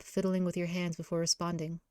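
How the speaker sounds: ordinary delivery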